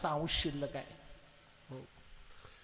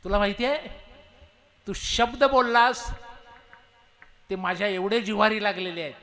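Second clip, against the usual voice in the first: hurt angry tiger; 4 semitones higher, 8 dB louder than usual. Acted. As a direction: press each word home. Tiger wounded by words.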